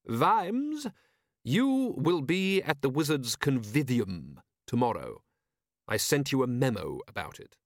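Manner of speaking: high opening call, then slow and deliberate; commanding patrician. Acted. Patrician ordering Vimes to attend, coolly commanding. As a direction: pin him down with measured authority.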